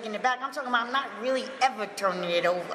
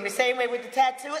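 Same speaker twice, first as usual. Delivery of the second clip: ordinary talk, nothing marked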